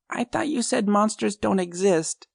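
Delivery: put-on high child's voice, tearful and sniffling; a scared boy arguing with his mom. A narrator's reading.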